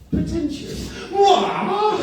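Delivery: very high, offended mock-posh squeal; posh mum impression. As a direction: squeal it back, scandalised and posh. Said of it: "And she goes".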